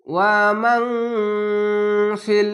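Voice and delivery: "ওয়া মান ফিল" with a long nasal hum on ন; chanted "ওয়া মান ফিল", ন stretched through the nose; ikhfa ghunna. Shown as a demonstration. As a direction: hide the ন of মান in a held hum before ফিল. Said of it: "এখানে ইকফা গুন্না".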